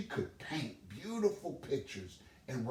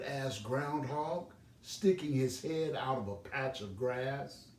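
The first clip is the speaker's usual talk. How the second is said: ordinary delivery, nothing marked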